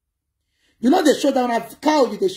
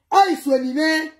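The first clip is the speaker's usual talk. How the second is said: ordinary delivery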